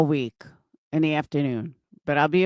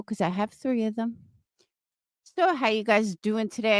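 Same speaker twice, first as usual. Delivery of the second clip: ordinary talk, nothing marked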